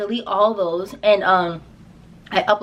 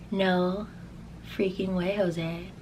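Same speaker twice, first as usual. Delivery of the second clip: stunned hush; 3 semitones lower, 10 dB quieter, much slower than usual. Retold or imitated. Stunned disbelief at seeing her face.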